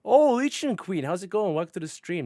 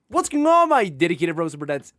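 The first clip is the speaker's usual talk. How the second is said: hype greeting; 8 dB louder than usual. Acted. Streamer greeting opening catchphrase.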